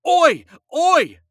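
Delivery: very high, loud yells; yelled. A narrator's reading. Husband rolling downhill in the trunk, loud yelling. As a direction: holler in panic. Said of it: "Yankel yelled".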